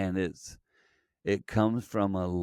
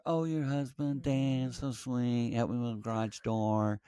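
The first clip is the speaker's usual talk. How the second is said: higher, softer imagined funeral guests; gushing condolence greeters; 4 semitones higher, 6 dB quieter than usual. Acted. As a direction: coo compliments to the widow, high and soft. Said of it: "people like you would come and greet her".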